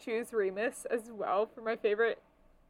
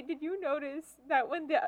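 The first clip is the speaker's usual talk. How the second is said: usual speaking voice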